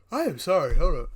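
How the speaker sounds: talking through a yawn; stretched yawn swallowing the words. Real, not acted. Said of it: "I don't know why I'm so tired".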